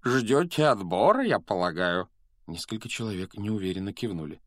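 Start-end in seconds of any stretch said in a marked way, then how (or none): 0.0-2.1 smiling preacher ghost. A narrator's reading. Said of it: "улыбаясь собравшимся".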